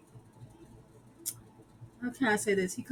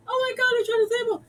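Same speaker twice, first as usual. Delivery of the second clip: high, rushed mock panic; retold panic; 11 semitones higher, much faster than usual. Stewart reacting to the alarm, mock panicked rush. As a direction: imitate a flustered, fast squeal.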